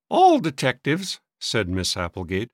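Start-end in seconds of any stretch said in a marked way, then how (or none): 0.1-1.2 high, disdainful pronouncement; querulous old maid. A narrator's reading. Sister judging detectives, disdainful. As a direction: declare it in a high fussy voice.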